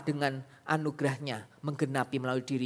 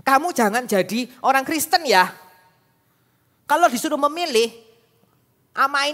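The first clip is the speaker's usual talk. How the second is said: imitating grandma; 9 semitones higher, 10 dB louder than usual. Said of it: "oma saya itu mengatakan apa".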